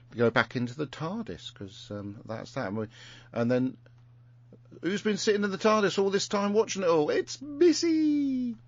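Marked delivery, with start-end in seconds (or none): 4.8-8.5 excited reveal. Acted. Dramatic reveal of the villain.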